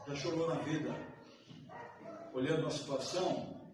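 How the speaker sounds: ordinary delivery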